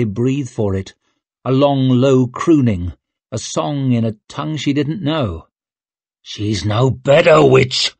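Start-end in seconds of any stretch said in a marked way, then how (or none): 6.2-7.9 raised voice, building to a loud final word; angry accusation. Acted. Someone confronting the witch over the patient, angry. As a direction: throw it hard at the witch.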